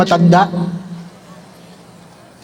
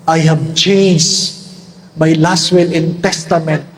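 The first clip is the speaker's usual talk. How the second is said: put-on slow old man's punchline; 5 semitones lower, slower than usual.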